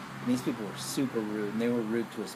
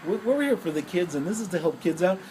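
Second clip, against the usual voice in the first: shaking with anger; 3 semitones higher than usual. Retold or imitated. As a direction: order them out, furious. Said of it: "I'm so mad, I'm shaking".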